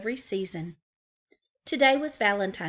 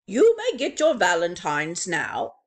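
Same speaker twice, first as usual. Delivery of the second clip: louder, different voice for the teacher; teacher owl; 7 dB louder than usual. Acted. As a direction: announce it like a kind teacher. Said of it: "said Mister Owl".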